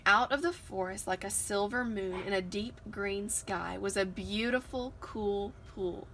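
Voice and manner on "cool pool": plain reading voice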